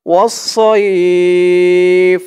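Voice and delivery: "সইফ" with its glide drawn out before the f; madd lin stretch. Shown as a demonstration. Teacher demonstrates the lin stretch, "সইফ" held long. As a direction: hold the vowel of "সইফ" long, then close. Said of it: "মধ্যে লিন এক আলিফ টান".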